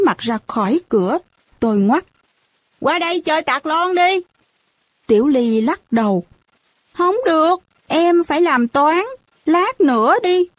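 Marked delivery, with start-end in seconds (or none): 2.8-4.2 high, inviting call at normal volume; pitched-up call to play; calling over. A narrator's reading. 6.9-10.5 put-on high little-kid voice, declining; childlike refusal in a high voice; reluctant child. A narrator's reading. Child character declines to play.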